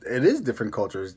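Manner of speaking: ordinary talk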